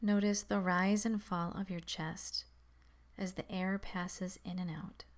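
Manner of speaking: calm, measured meditation voice with long pauses; guided meditation. Acted. Guiding beginners to focus on the breath, calm meditation voice. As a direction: settle listeners gently, leaving space between instructions.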